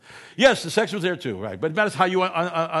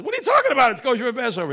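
sharply higher and louder, outraged disbelief; indignant protest; 9 semitones higher, 6 dB louder, much faster than usual. Retold or imitated. The fellow protesting to the rabbis, indignantly raised voice. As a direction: sputter back at the rabbis in disbelief. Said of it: "And he says".

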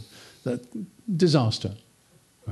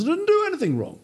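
indignant protest; faster than usual. Retold or imitated. Job protesting to his friends.

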